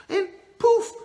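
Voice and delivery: high, loud burst on poof; magic poof. Acted. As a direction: pop it out like a magic spell. Wish magically granted.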